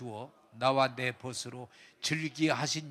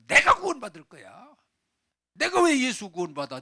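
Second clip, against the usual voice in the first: loud, raised proud declaration; defiant self-assertion; 7 semitones higher, 9 dB louder than usual. Acted. Proud man rejecting salvation through Jesus, defiant. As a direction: insist on doing it all yourself.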